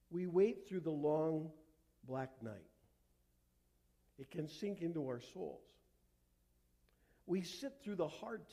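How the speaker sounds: hushed, solemn sermon meditation with long pauses; reflective preaching. Real, not acted. Preacher leading congregation to contemplate darkness and light, hushed and reflective. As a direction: let each phrase settle in silence.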